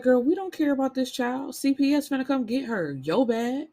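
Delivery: dismissive cop. Retold or imitated. Police mocking the arrested mother.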